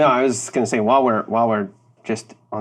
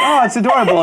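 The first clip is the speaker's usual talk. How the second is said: cooing; 10 semitones higher, 5 dB louder, faster than usual. Retold or imitated. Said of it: "you're all like".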